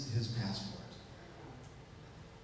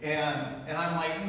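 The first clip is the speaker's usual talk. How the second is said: ordinary talk, nothing marked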